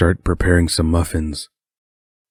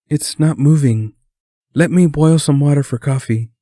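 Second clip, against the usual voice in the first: much higher, animated woman character; chatty Sue, fretting; 9 semitones higher, 4 dB louder than usual. A narrator's reading. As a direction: fret chattily in a high, lively voice. Voices Sue in the kitchen.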